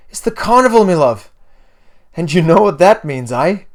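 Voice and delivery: loud, high, thrilled Irish mother; weird Irish accent. A narrator's reading. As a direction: share exciting news in a put-on Irish accent. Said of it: "a weird Irish accent".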